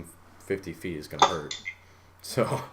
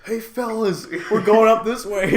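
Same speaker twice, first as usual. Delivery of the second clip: higher cheerful call to the squad; mock Russian kid; 12 semitones higher, 6 dB louder than usual. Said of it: "he says".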